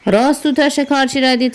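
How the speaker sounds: ordinary delivery, nothing marked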